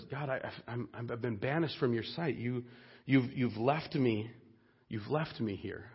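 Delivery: very soft, low, halting lament; utter despair. Acted. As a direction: falter quietly, as if abandoned by God. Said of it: "Jonah's in utter despair".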